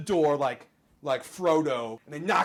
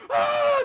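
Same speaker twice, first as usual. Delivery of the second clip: very high, loud panicked scream; 8 dB louder than usual. Acted. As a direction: shriek in terror as the bombs fall.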